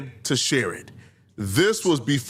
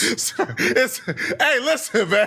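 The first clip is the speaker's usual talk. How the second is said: high, breaking into laughter mid-sentence; can't get the words out for laughing; 10 semitones higher than usual. Real, not acted. Host cracking up at his own point, laughing.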